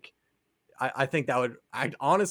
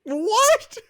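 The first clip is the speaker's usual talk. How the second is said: much higher, loud disbelieving cry; a friend's stunned shout at an absurd pitch; shocked shriek; 7 dB louder than usual. Real, not acted. Shocked reaction to CW Kingdom Hearts.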